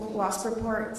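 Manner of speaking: normal talking voice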